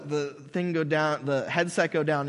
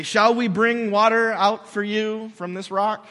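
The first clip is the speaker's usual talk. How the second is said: angry Moses; 5 semitones higher, 6 dB louder, slower than usual. Retold or imitated. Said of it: "He's mad".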